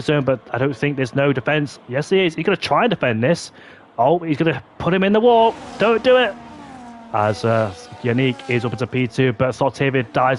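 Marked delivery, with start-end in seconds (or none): 4.0-6.3 excited. Real, not acted. Live call of crash.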